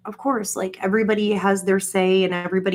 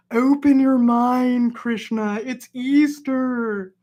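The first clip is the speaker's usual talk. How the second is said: slow, loud, drawn-out exasperation, higher; exasperated outburst; 4 semitones higher, 3 dB louder, slower than usual. Retold or imitated. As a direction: hammer each word at him, stretched out and loud. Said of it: "And I was like".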